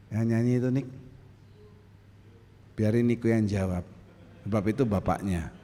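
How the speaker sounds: normal talking voice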